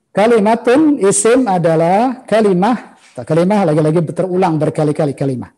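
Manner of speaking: normal talking voice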